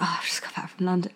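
quick whispered answer to a neighbour; sheepish whispered reply. Retold or imitated. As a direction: whisper it fast, under the breath. Muttered reply to neighbour, retold.

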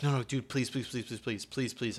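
quick retold pleading. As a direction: rattle off the pleases in one run. Retold begging of the driver.

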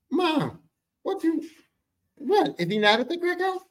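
very high incredulous question; can't believe she said it; stunned kid. Retold or imitated. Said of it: "did she just".

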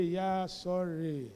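slow, drawn-out mock sympathy; mock pity. Preacher mocking people who offer pity, slow and drawn. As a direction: drag out the pity.

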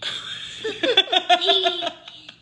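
high helpless laugh; burst of laughter. Real, not acted.

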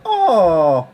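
high, loud Irish-style "Oh."; a hammed-up Irish "Oh.". Shown as a demonstration. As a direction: open the Irish accent with a big "Oh.". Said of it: "you have to start off every Irish accent with a".

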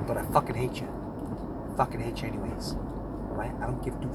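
low, close, menacing near-whisper; low menacing hiss. Acted. Hateful low rant.

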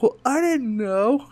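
put-on high, slow sheepish little boy; little boy excusing himself, high and slow; sheepish kid. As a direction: defend yourself like an embarrassed kid.

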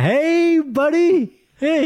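very high, loud, drawn-out hey buddy; fake-friendly cry. Doctor greets a colleague whose name he forgot, overly cheery. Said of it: "That's why I'm like".

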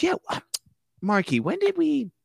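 high wife voice; mock wife. Wife asking husband about the house, high and airy. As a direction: ask it airily.

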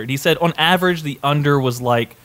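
ordinary delivery

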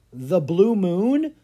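high, loud, slow question; loud surprised slow query; gasped guess. A narrator's reading. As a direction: gasp out the guess. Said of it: "Clara gasped".

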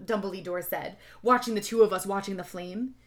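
plain reading voice

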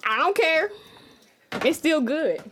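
high, loud, defiant retort. Real, not acted. Playful defence of Starbucks water.

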